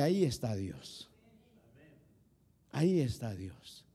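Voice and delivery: low, soft and slow, repeating it firmly; calm the doubter with a hushed certainty; quiet assurance. Retold or imitated. Answer to where God was. (con voz baja y serena)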